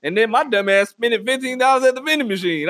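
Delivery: usual speaking voice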